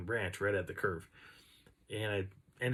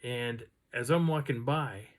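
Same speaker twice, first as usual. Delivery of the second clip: usual speaking voice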